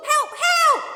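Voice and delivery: high, loud call for help; cry for help. Acted. Boy calling the village for help. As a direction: yell it out in panic.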